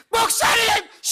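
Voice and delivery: high, loud panicky call; shrill alarmed call; frightened shout. Retold or imitated. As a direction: yell to the pastor in fright. Mother calls pastor in fright.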